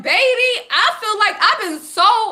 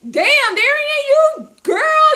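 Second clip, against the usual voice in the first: excited; 6 semitones higher, 3 dB louder, slower than usual. Retold or imitated. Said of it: "I'm like".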